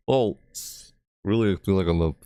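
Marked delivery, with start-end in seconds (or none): 0.1-0.9 "Bolts" pitched high, with a crisp b and ts; drawn-out higher "Bolts", every sound hit; over enunciating. Shown as a demonstration. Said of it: "having a tough time enunciating".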